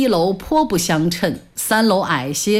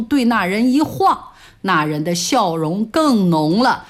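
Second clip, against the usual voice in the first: ordinary delivery, nothing marked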